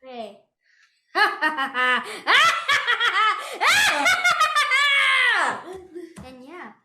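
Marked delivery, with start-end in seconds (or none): 1.1-6.2 loud, high cackling villain laugh; evil laugh. Shown as a demonstration. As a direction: throw back a big evil cackle. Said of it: "can I do an evil laugh right now to show you".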